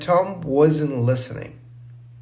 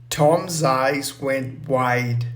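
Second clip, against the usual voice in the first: ordinary delivery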